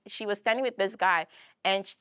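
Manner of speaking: usual speaking voice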